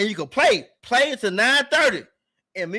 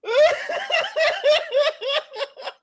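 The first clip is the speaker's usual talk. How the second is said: long high wheezing laugh; high laughing fit. Real, not acted. Cracking up over the clip, long high laugh. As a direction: lose it laughing.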